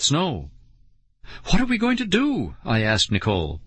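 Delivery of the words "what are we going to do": high, worried question; anxious kid in trouble; panicked. A narrator's reading. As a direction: plead for a plan, pitch way up. Panicked kid asks his sister.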